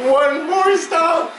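high, drawn-out fan shout; real high game-day holler; excited yell. Real, not acted.

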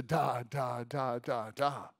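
a hint of baby diction; bouncy baby babble. Shown as a demonstration. Father showing baby diction, bouncy babble. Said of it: "bouncy, happy little way".

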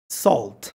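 Salt said as solt, closed vowel. Shown as a demonstration. Teacher models closed sound in Salt. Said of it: "va a sonar cerrada".

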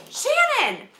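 a stranger calling across the aisle; calling out a name. Retold or imitated. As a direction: shout her name to get attention. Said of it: "somebody goes".

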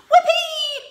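high, loud whoop with a laugh; a delighted princess crying out; gleeful cry. Acted. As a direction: shriek with joy. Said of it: "cried Sue".

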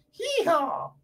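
very high, drawn-out cowboy whoop; playful hee-haw. Pretend. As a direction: whoop as if riding off on a horse. Pretends to ride off on horse.

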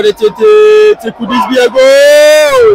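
rising thrill as a goal looks near; excited build. Real, not acted. Commentator to listeners on a chance, an excited goal shout.

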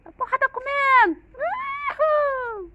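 high, loud whooping cheer; excited shout. Real, not acted. Owner cheering as the puppies fight over food, excited shout. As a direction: cheer the dogs on at the top of your voice.

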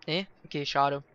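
usual speaking voice

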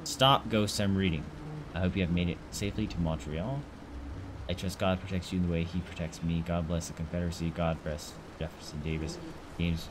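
low, quiet read-aloud of a letter. Acted. Gamer reading a Confederate letter aloud, hushed.

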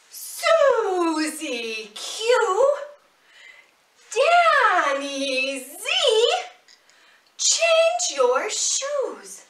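loud, high, slow drawn-out calling; shouting call. Pretend. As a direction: call them from across the house, drawn out. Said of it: "can you shout out with me?".

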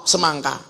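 "semangka" with open a sounds; ngapak pronunciation. Shown as a demonstration. Preacher listing ngapak "semangka" with open a. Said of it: "Apa maning yuk?".

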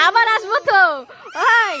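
reach her from across the room; calling out. Real, not acted. Calling to crying daughter. (কান্নারত মেয়েকে জোরে ডেকে বলা)

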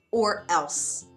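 usual speaking voice

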